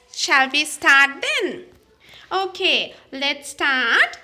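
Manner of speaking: normal talking voice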